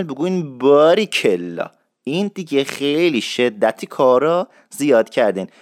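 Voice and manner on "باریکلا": باریکلا slowly stretched on a and i; long, lingering باریکلا; drawn out in full. Shown as a demonstration. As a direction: draw out both vowels of باریکلا.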